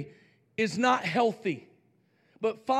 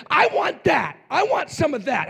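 higher, eager acted sorcerer; eager demand; 6 semitones higher, 4 dB louder than usual.

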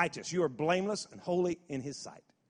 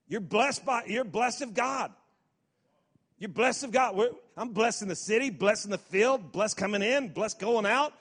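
rising, louder declaration of blessing; preaching at full force; 4 semitones higher, 3 dB louder than usual. Acted. Preacher building to the congregation, full force.

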